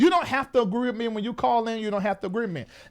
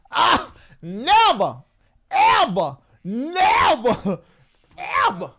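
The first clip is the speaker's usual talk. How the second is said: never ever drawn out slowly, each word hit; emphatic never ever; 3 semitones higher, 4 dB louder, much slower than usual. Real, not acted. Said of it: "You never did?".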